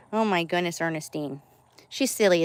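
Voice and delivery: normal talking voice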